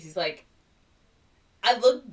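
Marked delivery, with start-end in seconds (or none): 1.6-2.0 higher and louder stubborn insistence; mock defiant teen. Ren refusing his mom about the tie, loud high defiant. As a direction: plant your feet and declare it.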